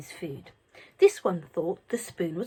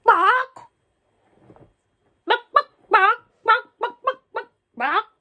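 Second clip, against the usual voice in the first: hen squawks; 14 semitones higher, 9 dB louder than usual. A narrator's reading.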